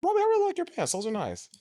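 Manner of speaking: very high, bright-toned compliment; high sweet compliment. Shown as a demonstration. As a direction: oversell the compliment playfully. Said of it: "I'll do it right now".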